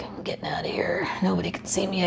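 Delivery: whispered, sneaking away; conspiratorial whisper; sneaky whisper. Retold or imitated. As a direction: hush it like slipping out unseen. Sneaking out with stolen food.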